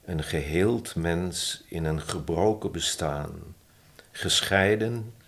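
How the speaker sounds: measured poetry reading with pauses between phrases; poem recitation. Acted. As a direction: recite the love poem, lifting key phrases and letting line endings fall. Reads own poem aloud.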